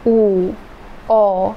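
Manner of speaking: ऊ and ओ held with rounded lips; rounded vowel demo. Shown as a demonstration. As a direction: round the lips and sound ऊ, then ओ. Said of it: "ये सब बोलने की time में थोड़ा सा गोलाकार फील कर रहे हैं". (होंठ गोल करके ऊ और ओ का उच्चारण)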